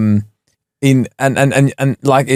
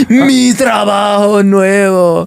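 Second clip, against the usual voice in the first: mock Mexican Spanish accent; 6 semitones higher, much slower than usual. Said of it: "Why was I Mexican?".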